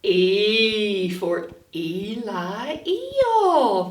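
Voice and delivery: very slow "E for Eli Eel"; slow letter phrase. Shown as a demonstration. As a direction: drag out each word for the class. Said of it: "Eli Eel's problem".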